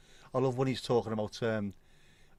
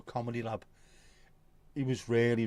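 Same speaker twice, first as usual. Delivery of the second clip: ordinary talk, nothing marked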